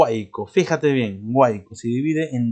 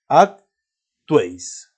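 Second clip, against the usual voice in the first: "Actuéis" very slowly in two beats, Ac - tuéis; split into syllables; 5 dB louder, much slower than usual. Shown as a demonstration. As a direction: break "Actuéis" after Ac, stretching both syllables, louder. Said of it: "¿Cuántas sílabas tiene? Dos".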